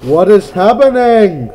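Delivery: loud, high startled cry; alarmed shout. Real, not acted. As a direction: blurt it out in shock. Startled by game event.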